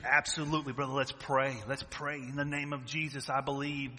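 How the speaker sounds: praying harder and harder for provision; fervent prayer. Retold or imitated. Praying for a brother, rising to a loud fervent cry.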